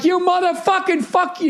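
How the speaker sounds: high-pitched mock cursing tirade; mock yelling. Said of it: "yelling at us".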